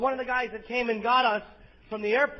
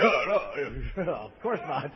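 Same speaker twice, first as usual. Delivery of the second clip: low, soft, unconvincing denial; feigned denial; 9 semitones lower, 8 dB quieter, slower than usual. Acted. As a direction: back down quietly with a badly faked no.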